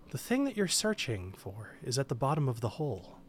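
softly voiced, gentle inner voice. Game master whispering a lure to a second player, gently. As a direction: keep it soft and gentle, unhurried. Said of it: "a gentle voice saying".

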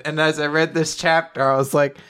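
ordinary delivery

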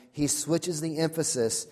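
usual speaking voice

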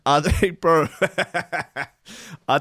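real laughter through the words; laughing. Real, not acted. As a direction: laugh your way into the confession. Host laughs at dancing ban.

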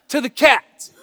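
loud, high gleeful reveal; owner's punchline. Acted. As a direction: burst out with the teasing payoff. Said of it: "then the owner goes".

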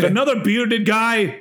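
lightly faked Swedish accent, slow and loud; bad Swedish accent. Fan faking a Swedish accent to sneak backstage, bad Swedish accent. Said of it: "That's not the best Swedish accent ever".

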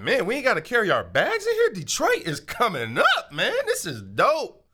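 high, quick, amazed chatter; excited. Retold or imitated. Arriving guest marvels to wife, excited and fast. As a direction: gush like a thrilled tourist.

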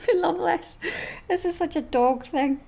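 high, laughing through the words; laughing delight. Real, not acted. Host laughing at the dog tweets. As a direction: giggle it out with delight.

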